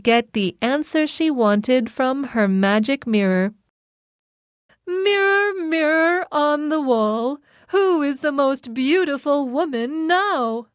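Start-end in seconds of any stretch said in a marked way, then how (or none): 4.9-10.7 higher, lightly voiced queen; evil queen voice. A narrator's reading. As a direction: ask the mirror as the queen. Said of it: "she asked".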